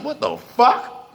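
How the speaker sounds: slow, high, incredulous what the fuck; real anger. Real, not acted. Reacting to the Dred Scott decision, slow and outraged. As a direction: stretch it out, high and stunned with anger. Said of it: "I'm sorry, I'm mad".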